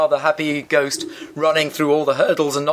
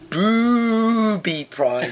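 slow drawn out; 5 semitones higher, much slower than usual. Acted. Punchline read, very slow.

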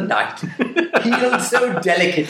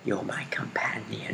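whispering; 4 semitones lower, 14 dB quieter than usual. Acted. Joking creepy whisper.

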